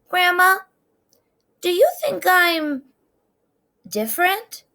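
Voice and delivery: put-on high, timid little girl voice; small girl asking. A narrator's reading. Trisha asking grandma on a walk, high and unsure. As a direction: ask it like a worried child.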